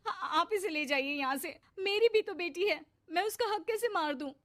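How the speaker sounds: high, loud, upset pleading; an overwrought mother refusing to give up her child's due; distraught protest. Acted. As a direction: protest in anguish, pitched well up.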